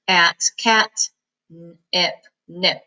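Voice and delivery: each syllable sounded separately; sounding out cat and nip. Shown as a demonstration. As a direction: build "cat" and "nip" piece by piece. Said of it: "each one has its own puff".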